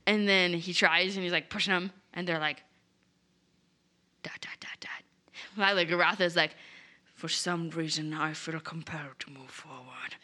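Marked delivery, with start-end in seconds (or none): 4.2-5.0 whispered dot dot dot. Retold or imitated. Speechless reaction, retold. 7.1-10.1 whispered, drawn-in mock mystery; mysterious whisper. Lila voiced mysteriously.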